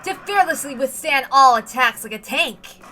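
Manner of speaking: loud, booming promo voiceover; trailer announcer. Acted. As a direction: sell it like an epic military trailer.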